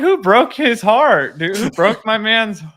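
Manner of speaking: high, loud, drawn-out protest; hammed-up indignation on your buddy's behalf; mock outrage.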